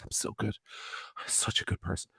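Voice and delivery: soft, breathy, unvoiced sanctimony; breathy mockery. Mocking a politician's pious self-praise, soft and breathy. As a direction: whisper it like a smug saint. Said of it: "breathily tell the world".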